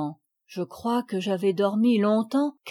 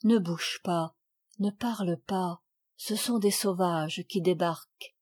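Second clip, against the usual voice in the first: softer, hushed urgent warning; said very low; 3 semitones lower, 5 dB quieter than usual. A narrator's reading. Father hushing child as savages land, low urgent. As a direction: keep it low so the savages don't hear. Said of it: "me dit tout bas".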